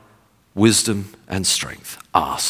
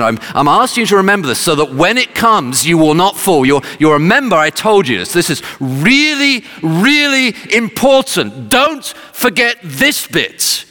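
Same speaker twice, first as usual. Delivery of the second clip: high, insistent plea, slowing and climbing towards the end; urgent retold appeal to remember; emphatic underlining; 8 semitones higher, 4 dB louder than usual. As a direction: underline it hard, stretching the key words. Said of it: "he's sort of underlining it".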